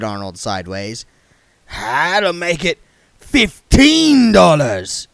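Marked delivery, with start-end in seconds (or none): 1.7-5.1 put-on raspy old beggar. A narrator's reading. Arnold sideways voice.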